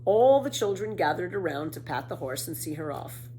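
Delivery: ordinary delivery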